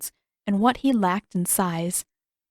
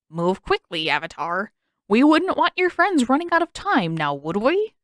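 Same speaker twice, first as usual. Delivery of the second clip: mocking villain, rising on the threat; taunting king; 4 semitones higher, 5 dB louder than usual. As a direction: sneer it like a cruel host.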